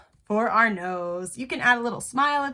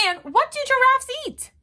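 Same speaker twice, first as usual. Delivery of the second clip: playful quiz; 13 semitones higher, 3 dB louder, faster than usual. Real, not acted. Quiz question to kids.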